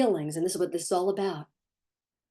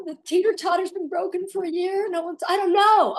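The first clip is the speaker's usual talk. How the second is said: put-on high, pleading kid voice, getting louder; whiny child; 8 semitones higher, 3 dB louder than usual. A parent mocks a child's whining excuses in a high, pleading voice. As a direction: beg like a spoiled kid defending his ball. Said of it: "And my perspective is".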